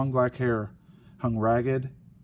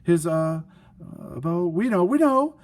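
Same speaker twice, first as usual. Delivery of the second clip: high, louder panicky we know; flustered bluffing; 6 semitones higher, 4 dB louder than usual. Acted. Sisters bluff the invisible being's sister, high and panicky.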